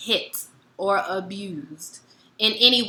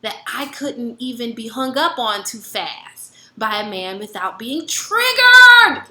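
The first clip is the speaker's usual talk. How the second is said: ordinary talk, nothing marked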